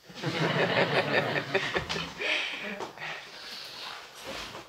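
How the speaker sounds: breathy embarrassed laugh. Real, not acted. Shy laugh after accent joke.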